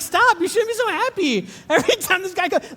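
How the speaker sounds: high mock protest; mock jealous. Husband mock-scolding wife over the TV doctor. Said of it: "I'm like".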